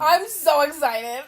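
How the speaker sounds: slow, gushing excitement; excited. Real, not acted. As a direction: stretch it out, thrilled. Excited about Barbenheimer.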